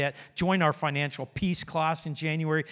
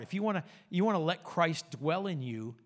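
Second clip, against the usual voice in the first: normal talking voice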